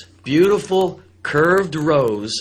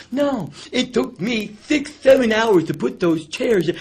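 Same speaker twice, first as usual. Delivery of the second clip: higher, aggrieved owner voice; whiny club owner; 8 semitones higher, slower than usual. Retold or imitated. Owner to the host backstage, aggrieved complaining. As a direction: complain about the chairs. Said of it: "he talked like this".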